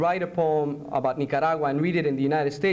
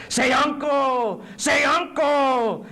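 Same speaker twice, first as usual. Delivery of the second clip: loud, high, drawn-out command; torturer demanding surrender; 10 semitones higher, 4 dB louder, much slower than usual. Acted. Interrogator forcing a prisoner to give in, loud slow shout. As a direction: shout it slowly, twice, like an order.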